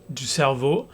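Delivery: ordinary talk, nothing marked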